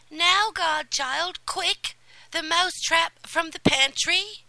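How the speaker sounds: high, loud, eager commands; excited fairy calling for the mousetrap; urgent fairy godmother. Acted.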